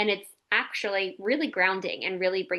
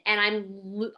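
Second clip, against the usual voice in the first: ordinary talk, nothing marked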